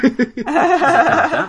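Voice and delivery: laughing. Real, not acted. Laughs while talking.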